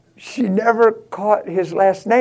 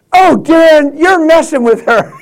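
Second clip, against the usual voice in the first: higher, louder chiding wife; wife calling out Dan for teasing the girl, loud and higher; 8 semitones higher, 6 dB louder than usual. Retold or imitated. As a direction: call him out for his prank. Wife exposes the joke.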